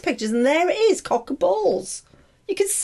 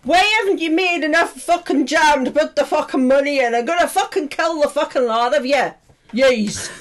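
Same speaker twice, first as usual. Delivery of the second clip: put-on Irish accent. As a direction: lay into the gang in hammed-up Irish. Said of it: "begin with the Irish head of head".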